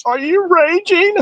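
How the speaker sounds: slow, very high put-on voice; drawn-out falsetto teasing question; mock concern. Host taunting a woman who says she's raging, mock concern.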